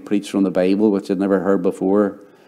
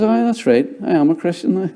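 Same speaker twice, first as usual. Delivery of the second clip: mock bluffing reply; 8 semitones higher than usual. Bluffing answer to the coworker.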